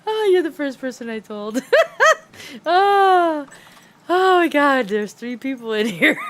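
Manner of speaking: can barely speak for laughing; helpless laughter. Real, not acted. Telling the viewer about Beef's anger, helpless laughter.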